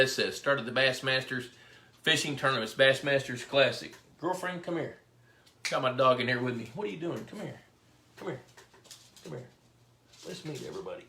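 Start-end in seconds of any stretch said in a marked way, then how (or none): 4.2-5.0 coaxing the dog. Real, not acted. Host softly calling his puppy into the room, coaxing. 6.7-11.0 soft, hushed coaxing to a dog; pet talk. Real, not acted. Host coaxing his puppy to meet viewers, hushed pet talk.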